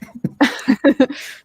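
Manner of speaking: short real chuckle; spontaneous little laugh; amused laugh. Real, not acted. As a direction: laugh briefly before carrying on. Amused aside about young audience.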